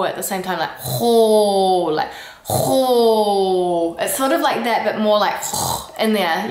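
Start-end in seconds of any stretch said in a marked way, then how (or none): 0.8-4.0 louder, throaty annoyed ho; loud guttural ho. Shown as a demonstration. Demonstrating an annoyed French ho. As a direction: bark a throaty ho. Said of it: "you can also add to it".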